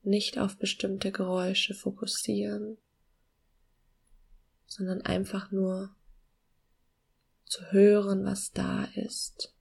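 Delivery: slow, soft meditation voice with long pauses; calm guiding voice, gently slowing down; guided meditation. Acted.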